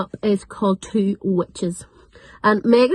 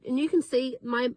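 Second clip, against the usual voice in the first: usual speaking voice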